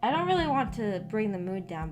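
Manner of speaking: ordinary talk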